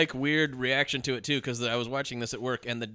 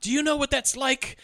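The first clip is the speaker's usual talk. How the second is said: mock yell; 10 semitones higher than usual. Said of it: "she's yelling at the cop".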